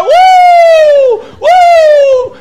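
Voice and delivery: loud, very high, long "woo"; imitating an ecstatic worshiper's holler; shouted whoop. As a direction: let out a piercing revival whoop. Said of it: "he was shouting, he was going".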